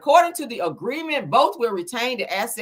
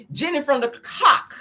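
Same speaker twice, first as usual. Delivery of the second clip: laughing through "Jenny from the Cock", pitched up; 7 semitones higher than usual. Real, not acted. Giggling to viewers over her pun on "Jenny from the Cock".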